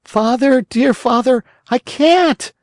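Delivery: a puppet unable to stand; in despair. A narrator's reading. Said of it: "answered the Marionette in despair".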